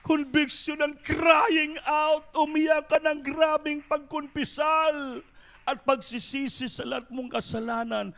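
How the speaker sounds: full-voiced pulpit exhortation; fervent preaching. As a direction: preach it with growing fire.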